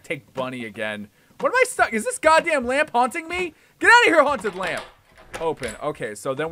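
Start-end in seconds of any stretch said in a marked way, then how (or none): 1.4-4.8 high, loud indignant complaint; exasperated outburst. Real, not acted. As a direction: scold the object that is blocking you. Stuck, yells at the lamp.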